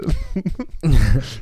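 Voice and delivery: bright burst of laughter; laughing. Real, not acted. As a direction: laugh at your own bit. Laughs after the act.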